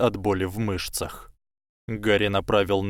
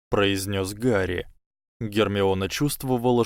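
ordinary delivery, nothing marked

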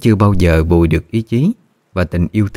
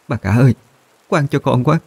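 put-on high, pleading young woman begging through tears; tearful pleading; 8 semitones higher than usual. A narrator's reading. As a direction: beg the mistress for mercy, voice climbing higher. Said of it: "khổ sở khóc lóc van xin". (người hầu quỳ khóc van xin bà cả, giọng cao nghẹn ngào)

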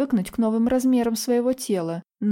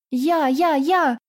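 put-on high, eager child's clamour; excited squeal; 6 semitones higher, 4 dB louder, much slower than usual. A narrator's reading. Children beg to fly as dragons, squealing excitedly. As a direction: pipe up high like a child begging to be picked. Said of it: "детская потонула в восторженном писке".

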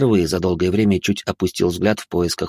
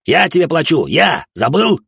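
angry yell; 9 semitones higher, 5 dB louder than usual. A narrator's reading. Merchant yells at robot.

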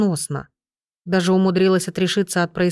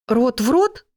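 startled question, pitched up at the end; mildly shocked, higher-pitched query; blurted question; 5 semitones higher than usual. A narrator's reading. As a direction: blurt it out in disbelief. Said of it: "выпалила я".

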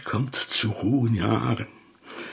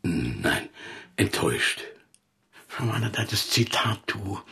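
ordinary talk, nothing marked

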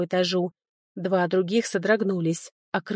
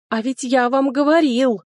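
жалобный стон, выше и громче; ныть с упрёком хозяину; стеная; 6 semitones higher, 5 dB louder than usual. Acted. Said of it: "стенал Макс". (Макс стенает вслед господину)

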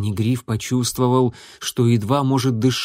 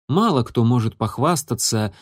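plain reading voice

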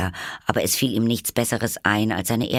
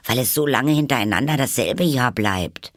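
high, louder eager explanation; quick reply; 6 semitones higher, 5 dB louder than usual. A narrator's reading. Alice explains the year, quick. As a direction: explain readily, pitched high. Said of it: "antwortete Alice schnell".

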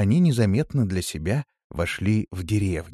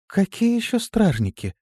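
high-pitched puzzled question; surprised; 12 semitones higher than usual. A narrator's reading. Листи turning to her friend in surprise, astonished. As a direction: shoot back an astonished question. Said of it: "с удивлением взглянув на подругу".

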